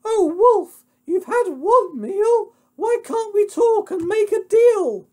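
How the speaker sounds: very high, loud, pleading falsetto; pig squeal. Acted. Pig begs the wolf for a deal, squealing high. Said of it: "The little pig began to squeal".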